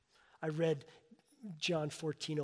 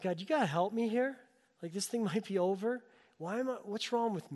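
higher, louder pleading prayer, voice climbing; desperate plea to God; 7 semitones higher, 5 dB louder than usual. Retold or imitated. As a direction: beg God for help, half frantic. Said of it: "I went".

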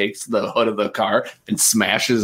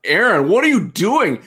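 higher, louder call of alarm; [name] calls out high and loud to Aaron in alarm; 10 semitones higher, 4 dB louder than usual. Acted. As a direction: call out to stop your friend.

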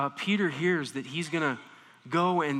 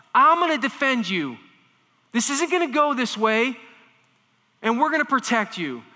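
louder, higher protective vow; intense vow; 6 semitones higher, 5 dB louder than usual. Retold or imitated. Peter vowing protection, loud and intense. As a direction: swear to defend him. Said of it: "He's saying this with intensity".

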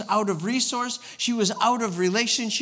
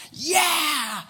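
excited cheer; 6 semitones higher than usual. Retold or imitated. Mimicking psyched crowd.

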